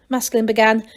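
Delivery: plain reading voice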